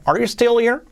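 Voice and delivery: high pitch, rising on HERE in "still here"; questioning intonation. Shown as a demonstration. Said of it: "la entonación, muy importante". (entonación de pregunta, subiendo en here)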